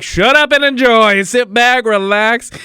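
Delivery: loud, high mock snap back; cheeky loud brush-off; brash retort. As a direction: tell the prude off with relish. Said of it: "then you're just like".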